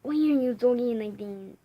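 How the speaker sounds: loud, challenging put-on voice. Questioning the other one's accent, loud put-on voice. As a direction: challenge them loudly in an assumed voice.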